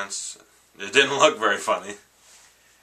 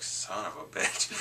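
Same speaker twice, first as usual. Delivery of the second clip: mock angry mutter; 3 semitones lower, 8 dB quieter, slower than usual. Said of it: "he was kinda pissed off".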